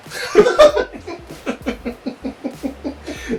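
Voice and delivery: long high burst of laughter; real loud laughter. Real, not acted. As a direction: crack up. Big laugh.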